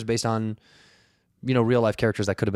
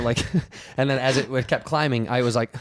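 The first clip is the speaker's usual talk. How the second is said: normal talking voice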